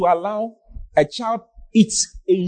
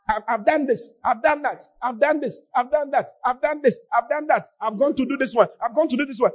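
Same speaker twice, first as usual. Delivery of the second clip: higher singsong list of excuses; mock recitation; 5 semitones higher than usual. Preacher mimicking a church member's list of excuses, mock recitation. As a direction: imitate someone reeling off the same excuses.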